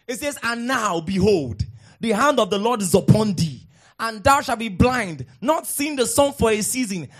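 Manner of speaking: loud preaching of the curse; fiery scripture reading. Acted. Preacher reading Paul's curse to the church, loud and fiery. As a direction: thunder the verse like a rebuke.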